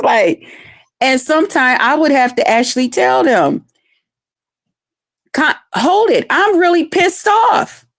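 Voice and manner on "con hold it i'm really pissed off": high, slow, emphatic telling-off; serious, pissed off. Retold or imitated. Aunt shows nieces she is not joking, retold anger. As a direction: lay down the law, drawing out the words. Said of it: "She's serious now".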